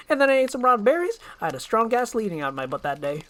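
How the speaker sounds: a motor-mouth sidekick gushing praise; fast-talking eager donkey. Acted. Donkey keeps babbling.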